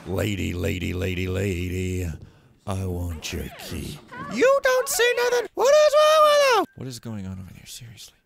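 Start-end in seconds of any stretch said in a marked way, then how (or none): 0.1-4.0 droning, repeated nagging; insistent pestering. Pretend. Player pestering a lady for her key, insistent drone. 4.3-6.6 very high, full-volume screech; outraged screaming. Real, not acted. Player screaming at guards who spot him, outraged.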